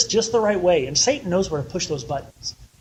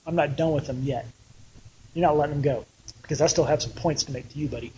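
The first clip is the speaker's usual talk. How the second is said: lower, softer, fast and teasing; lightly mock God; 4 semitones lower, 6 dB quieter, faster than usual. God teasing Pharaoh in a low, soft, fast aside. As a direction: shrug it off with a wry buddy. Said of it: "And God goes".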